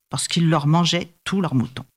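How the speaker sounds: ordinary delivery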